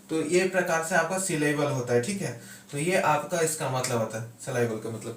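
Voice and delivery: normal talking voice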